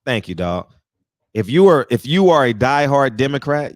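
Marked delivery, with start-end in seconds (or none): 1.3-3.8 louder, hammering the first words; loud punchy rant start; emphatic loud opening. Real, not acted. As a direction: come in hard to grab attention.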